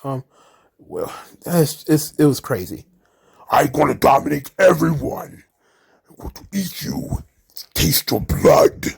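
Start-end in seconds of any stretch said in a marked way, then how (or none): 3.5-8.9 a hammed-up giant's menacing whisper; mock Omos monster voice. Podcaster mimicking Omos yelling at the floor, hammed-up whisper. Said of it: "Omos starts yelling at the floor".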